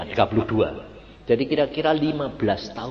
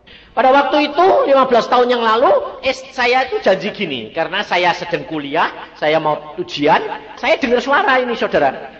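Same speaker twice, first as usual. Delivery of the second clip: louder, higher preaching; fervent preaching; 8 semitones higher, 8 dB louder than usual. Acted. Preacher to church, loud fervent telling. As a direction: preach it out with force.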